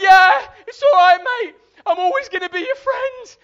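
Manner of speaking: high, hammed-up panicked yell; screamed reassurance. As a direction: scream forgiveness over the ride. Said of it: "just scream".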